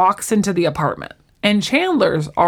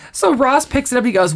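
normal talking voice

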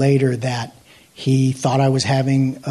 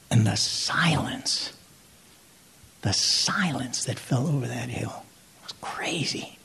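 soft, breath-heavy hush; slow down and let it fade to a whisper; softly reliving a stunned silence; hushed awe; 9 dB quieter than usual. Real, not acted. Reliving hushed moment after crucifixion.

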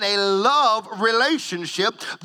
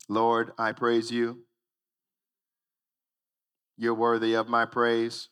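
going through the motions; 7 semitones lower, 3 dB quieter than usual. Pretend. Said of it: "here's what we do sometimes".

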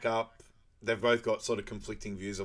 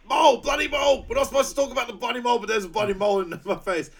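much higher, louder shouted tirade; mock furious rant; 12 semitones higher, 8 dB louder, faster than usual. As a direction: explode about the mole. Acting character's furious outburst.

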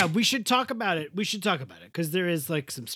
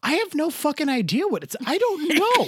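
higher-pitched, exasperated protest; exasperated retelling; 7 semitones higher than usual. Retold or imitated. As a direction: act out throwing your hands up at the question. Acting out her exasperated answer.

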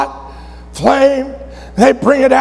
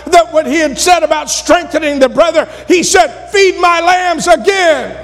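fervent preaching, higher pitch; 5 semitones higher than usual. Acted. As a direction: drive it home to the congregation. Preacher pressing the point.

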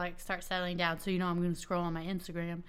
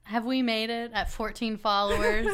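higher, excited self-mocking question; mock triumph; 4 semitones higher, 4 dB louder than usual. Celebrating fourteen followers, mock-triumphant excitement. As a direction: build to a loud laughing cry.

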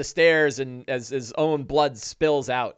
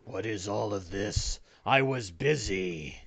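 annoyed heavy-booted orc; 5 semitones lower, 7 dB quieter than usual. Acted. Newcomer from upstairs in heavy boots, low annoyed grumble. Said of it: "from upstairs, you hear heavy boots".

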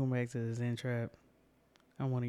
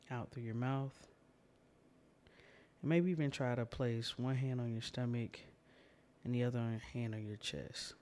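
guided breathing meditation. Acted. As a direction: leave room for each breath.

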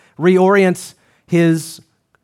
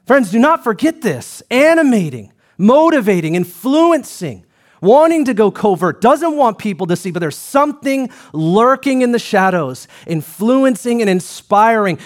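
raised, driving sermon delivery; preaching; 4 semitones higher than usual. Acted. Pastor warning the church about dark powers, fervent preaching. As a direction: preach it hard to the congregation.

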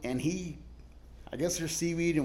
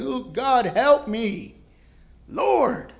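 mock earnest cry; 7 semitones higher, 6 dB louder, much slower than usual. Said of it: "an earnest cry from my heart".

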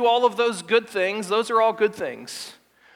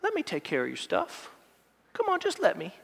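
soft, timid begging; timid young man; 3 semitones lower, 8 dB quieter, faster than usual. Retold or imitated. Preacher imitating a timid young man, soft and pleading. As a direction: mumble the plea, shy and soft. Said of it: "this timid young man".

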